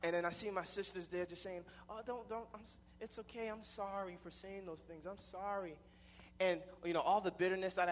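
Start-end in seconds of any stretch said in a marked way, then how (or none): 1.9-5.8 higher, softer imitated sisters' voice; soft consoling apology. Sisters consoling their crying stepfather, soft pleading apology. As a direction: gently soothe and apologise.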